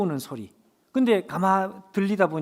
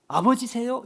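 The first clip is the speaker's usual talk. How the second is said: calling out; 7 semitones higher, 3 dB louder than usual. Retold or imitated. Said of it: "한마디 소리를 쳤습니다".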